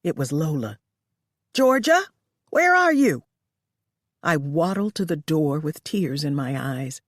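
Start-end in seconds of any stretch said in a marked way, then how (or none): 1.5-3.2 high, loud call across the house. Acted. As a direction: call her name up and out. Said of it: "I heard someone call".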